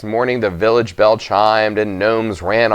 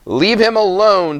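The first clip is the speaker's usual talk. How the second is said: put-on much higher, firm girl's voice; Red defending; 11 semitones higher than usual. A narrator's reading. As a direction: stand up to the bullies.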